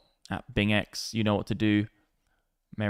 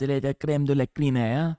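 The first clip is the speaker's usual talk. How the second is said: put-on French accent; 3 semitones higher than usual. Joking as if you're in France, mock French accent. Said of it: "It's like you're in France".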